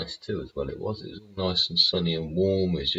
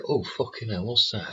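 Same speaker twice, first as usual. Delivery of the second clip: mock surprise; 7 semitones higher than usual. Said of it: "And you think".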